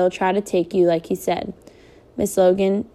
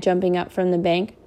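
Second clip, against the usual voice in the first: ordinary delivery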